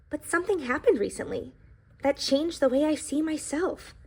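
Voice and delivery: storybook read-aloud voice; reading to children. A narrator's reading. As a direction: tell the pie's story to a classroom. Reads the book aloud.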